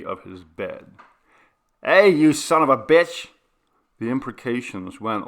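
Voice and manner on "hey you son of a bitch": loud, high furious yell; enraged neighbour hollering; angry shout. Acted. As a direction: curse him out at full volume. Angry man at the door.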